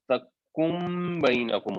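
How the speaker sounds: تکم بینکم slowly, n turned to m before b; n merged into m. Shown as a demonstration. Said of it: "دب جانی ہے اور آگے شامل ہونی ہے".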